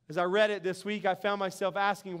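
normal talking voice

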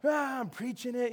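strained pretend preacher cry, kept soft; mock impassioned preaching. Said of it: "I was preaching my guts out".

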